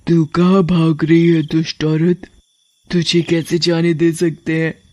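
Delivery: lightly voiced menacing witch queen; witchy threat, slowing at the end. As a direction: confront with a touch of regal menace. Witch queen catches Shalu.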